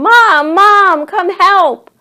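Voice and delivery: high, loud frantic holler; screaming for help. A narrator's reading. Charlie runs down the path for Mom, screaming.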